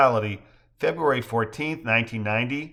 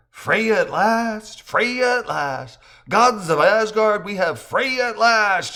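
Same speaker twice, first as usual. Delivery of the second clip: excited exclaim; 10 semitones higher, 6 dB louder, slower than usual. Acted. Joe exults over Freya.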